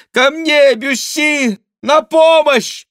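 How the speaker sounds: very high, loud cry for help; screaming. A narrator's reading. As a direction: scream for rescue, stretching the last words. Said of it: "кричала Диана". (отчаянный женский крик о помощи)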